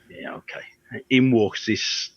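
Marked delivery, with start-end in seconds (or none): 0.1-1.0 breathy whisper, barely voiced; whispered thought. Waiting alone in the boardroom, whispering to himself. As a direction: whisper it to yourself, bored.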